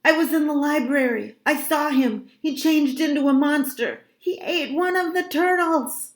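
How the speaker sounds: high, urgent, frightened report; alarmed, high-pitched outpouring; panicky insistence. A narrator's reading. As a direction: blurt it out desperate to be believed. Frightened child pleads to be believed.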